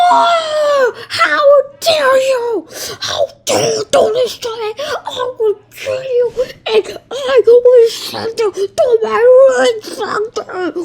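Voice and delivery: furious, high-pitched villain rant; shaking with anger. Acted. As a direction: rage at him, voice breaking. Villain Rage Man furious outburst.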